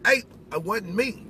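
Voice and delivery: ordinary talk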